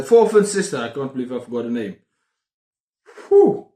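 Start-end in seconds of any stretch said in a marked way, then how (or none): 3.3-3.7 excited whoop. Real, not acted. Excited over the book.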